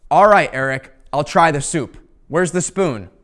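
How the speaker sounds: loud, fed-up concession; exasperated giving in. Retold or imitated. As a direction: give in with a loud huff. Dad finally giving in.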